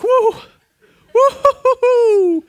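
high, loud laughing "woo"; woozy woo. Retold or imitated. Father nearly fainting at the birth, high nervous laughing whoops. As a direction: shake off the dizziness with a nervous whoop. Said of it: "I'm like".

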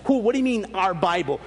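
ordinary delivery

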